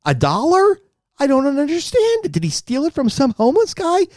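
can't believe what he's hearing; mocking the cheap wine in disbelief; incredulous. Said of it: "I was so mad".

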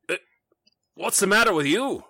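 a light barkeep bark at a stranger; gruff barkeep. Acted. Tavern keeper challenges the weeping stranger, loud and brusque.

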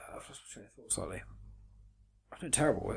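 groggy and worn out; foggy, tired mumble; hungover. Real, not acted. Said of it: "I think it's 'cause I'm so hungover".

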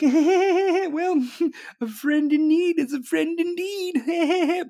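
high, slow cackling mock voice; cackling witch. Comedian mocking the vice president as a cackling witch. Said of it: "you're cackling like a fucking witch".

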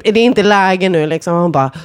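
ordinary delivery

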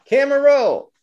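calling roll. Retold or imitated. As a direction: call it across the set. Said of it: "the director comes".

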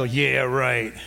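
low mock-skeptical scoff; doubting inner voice. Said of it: "a little voice inside go".

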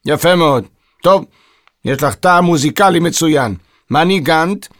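brisk, mildly testy approval; a touch of impatience; impatient. A narrator's reading. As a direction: humour the fan impatiently. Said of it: "בחוסר סבלנות".

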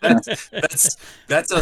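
high, giggling through words; laughing. Real, not acted. Laughing while talking.

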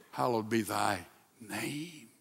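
low, soft, slow prayer; reverent. Acted. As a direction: speak it like a hushed prayer.

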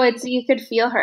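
ordinary talk, nothing marked

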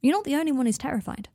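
ordinary delivery

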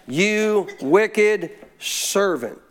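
very slow, drawn-out solemn rebuke; God speaking. Acted. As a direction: stretch every word as God's judgment on "wicked". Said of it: "This is God talking".

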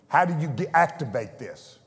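ordinary talk

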